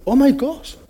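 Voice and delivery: high, mock thrilled gasp. The speaker pretending he gasped at the consul's call, mock thrilled. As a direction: pretend to be overwhelmed, hammed-up. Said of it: "No, I'm kidding".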